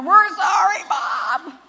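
put-on high, loud childlike wail; hammed-up kids' sorry; imitated crying kids. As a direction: whine an apology like tearful children. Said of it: "my kids are all out there crying".

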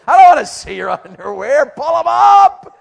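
loud, high, laughing outburst; half-laughing yell, pull 'em up; laughing shout. Real, not acted. Laughing exasperated shout at crowd.

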